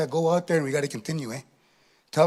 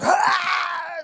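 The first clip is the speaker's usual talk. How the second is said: high, drawn-out laughing cry; hard laughing howl; 12 semitones higher than usual. Shown as a demonstration. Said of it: "Laugh hard".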